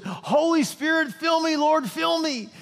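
high, loud, pleading cry; fervent shout. Retold or imitated. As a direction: throw the arms up and cry out to God. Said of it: "he says".

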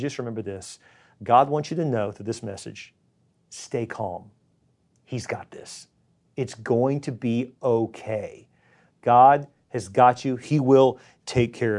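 a pastor gently calming his anxious listeners; calm reassurance. Real, not acted. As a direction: hush them and promise it will be fine. Soothing the congregation.

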